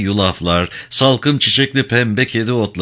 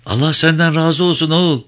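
put-on higher, blessing old woman's voice; old Kurdish mother thanking the young ferryman; grateful old woman; 8 semitones higher than usual.

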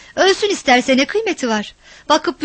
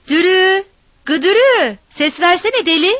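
high, loud shouts of the name; calling out; 6 semitones higher, 6 dB louder than usual. A narrator's reading. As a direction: holler for her to answer. Searching, calling the girl.

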